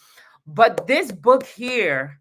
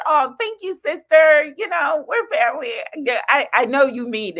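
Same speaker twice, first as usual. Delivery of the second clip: touched; 8 semitones higher, 5 dB louder than usual. Real, not acted. Touched reply to sister's comment.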